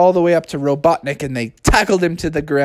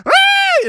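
much higher cartoon scream. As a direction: shriek as it spins. Robot spins out.

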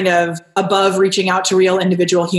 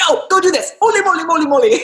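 put-on Polish accent; 8 semitones higher, faster than usual. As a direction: bark orders in a Polish accent. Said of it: "yell at you in his Polish accent".

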